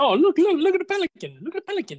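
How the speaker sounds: high-pitched bad Scarface accent; horrible Scarface accent. Quoting the movie Scarface to the co-host in a horrible accent. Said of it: "I'm doing a horrible accent".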